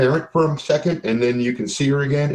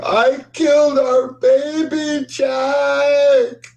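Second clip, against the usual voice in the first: high, slow anguished wail; sobbing scream; 14 semitones higher, 3 dB louder, much slower than usual. Acted. Game master as Susie confessing from the sky, sobbing scream. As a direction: scream it out in drawn-out, hammed-up grief. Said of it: "She screams out, sobbing".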